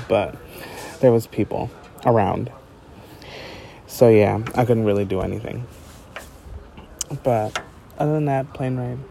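ordinary talk

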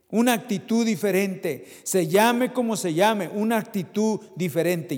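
usual speaking voice

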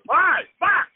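sharp yelp of pain, then a curse; pained yell. Real, not acted. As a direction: cry out as if it really hurts. Sudden pain outburst.

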